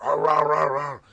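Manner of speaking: loud, high barking "rah rah"; dog bark imitation. Imitating the dog lunging at him.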